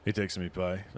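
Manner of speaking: ordinary talk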